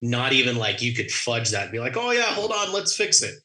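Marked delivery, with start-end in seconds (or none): 2.0-3.4 high, breezy put-on voice; mock casual. Pilot imagining fudging the departure, mock casual. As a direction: toss it off as a pitched-up imagined quick fix.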